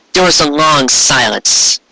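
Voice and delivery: ordinary delivery, nothing marked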